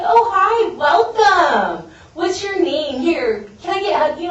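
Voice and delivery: high, loud, gushing greeting; excited welcome. Retold or imitated. Meeting members welcome the newcomer, excited and gushing. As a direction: imitate overjoyed greeters swooping in on a newcomer. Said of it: "People were excited I was there".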